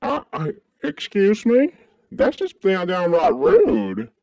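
high, drawn-out mock outrage; affronted. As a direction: take loud offence, stretching the words. Takes offence at redneck jibe.

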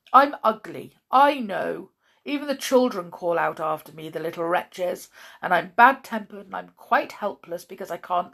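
loud, aggrieved outburst; bitter self-pity. A narrator's reading. Blind man telling a stranger his troubles, bitterly.

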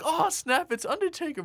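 high-pitched stunned onlooker; hammed-up surprise, not shouted; mock astonishment. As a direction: gasp it high, as if spotting someone unexpected. Acts out stunned onlookers' reaction.

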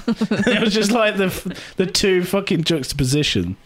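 ordinary delivery

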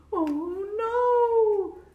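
gasp, then high, loud wail; alarmed gasp. A narrator's reading. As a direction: draw out a dismayed cry. The tree topples, alarm.

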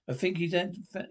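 ordinary talk

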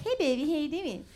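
put-on cutesy baby-voice greeting; demonstrated baby talk. Shown as a demonstration. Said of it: "I didn't even know I was doing it".

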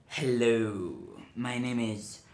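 slow voice sinking into a hushed whisper; sinister advisor. Acted. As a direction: introduce yourself like an evil dragonborn, whispering the name. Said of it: "He sounds evil".

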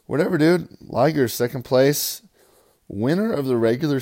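loud proud second place; proud announcement. Real, not acted. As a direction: announce it like a trophy.

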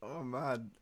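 breathless laughing sigh; winded from laughing; hysterical laugh. Real, not acted. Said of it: "I've laughed that much".

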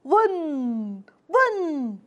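voiced race car revs; drawn-out "vroom" with falling pitch; engine noise. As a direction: play at revving toy cars. Imitates race cars revving.

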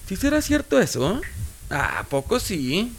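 put-on sing-song Aguascalientes accent, high then drawn out; hidrocálido accent. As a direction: swoop through it in an Aguascalientes lilt. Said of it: "Pero así como el".

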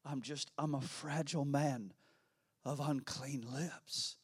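Isaiah whispering his weakness before God; broken, fragile plea. A narrator's reading. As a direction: quietly confess your weakness, nearly breaking. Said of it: "so weak and so broken".

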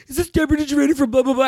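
high-pitched mock fanboy gush; imitating a starstruck boy; excited fan. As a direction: rattle it off high like an eager fan. Imitating excited boys who recognize her.

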